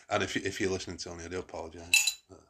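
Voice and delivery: usual speaking voice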